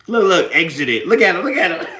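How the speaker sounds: loud laughing jeers. Real, not acted. Mocking a troll while laughing. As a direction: jeer and laugh at him.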